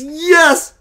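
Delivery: a high, lightly voiced otherworldly yes; entity's yes. Spirit guide confirming the butterfly to Keith, high airy yes. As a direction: answer as a gentle spirit guide, lifted and airy.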